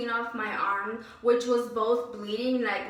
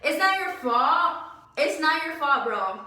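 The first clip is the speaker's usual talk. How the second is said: mock sarcasm; 4 semitones higher, 5 dB louder than usual. Mocking the boss's excuse.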